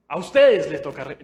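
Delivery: loud mock pastor scolding; mock preacher. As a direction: play the pulpit preacher. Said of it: "el pastor acá y". (pastor imitado, con tono fuerte)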